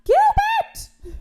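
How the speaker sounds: very high, loud mock yell; imitated shrieking yell. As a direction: holler the name in disbelief. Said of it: "I yelled out".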